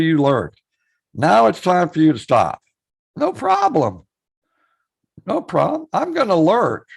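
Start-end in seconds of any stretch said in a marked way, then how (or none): none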